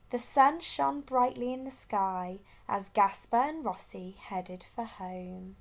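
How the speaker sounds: ordinary delivery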